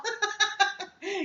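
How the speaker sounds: a short, soft, flustered laugh; soft embarrassed laugh. Real, not acted. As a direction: laugh it off, touched and shy. Touched by viewers' kind comments.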